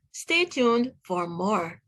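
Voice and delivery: ordinary delivery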